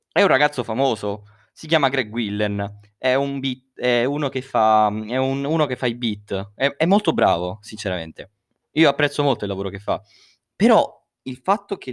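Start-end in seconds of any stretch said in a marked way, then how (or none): none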